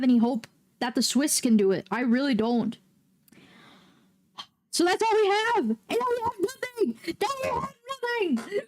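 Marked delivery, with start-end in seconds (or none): 4.7-8.6 high, whiny mock sobbing; melodramatic wail. Real, not acted. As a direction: cry out as if all hope is gone. Wailing over the lost match.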